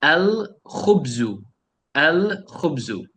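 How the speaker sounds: slow "El khubzu" with a long, clear L; measured teacher's "El khubzu", distinct L; clearly sounded L. Shown as a demonstration. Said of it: "all of them I'm pronouncing them clearly".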